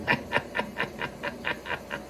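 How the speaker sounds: a whispered villain's laugh; diabolical laugh. Shown as a demonstration. Narrator to listeners, imitating Race's diabolical laugh. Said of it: "Diabolical laughter".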